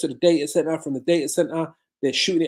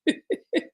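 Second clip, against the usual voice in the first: a quick high burst of laughter; a real laugh that slips out; quick laugh he apologises for; short real laugh. Real, not acted. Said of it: "Sorry, I don't mean to laugh".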